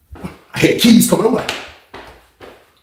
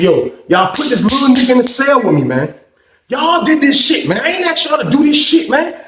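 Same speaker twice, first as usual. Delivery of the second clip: high-pitched indignant complaint, acted out; exasperated protest; 8 semitones higher than usual. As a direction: protest to the lieutenant in mock outrage.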